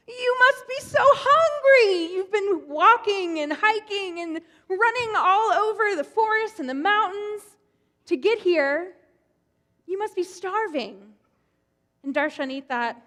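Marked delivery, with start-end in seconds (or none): 0.1-11.1 high, loud, fussing host voice, drawn out; high-pitched welcoming patriarch; kindly old Abraham. Acted. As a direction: fuss over a weary guest like a doting old host. Abraham voiced in told story.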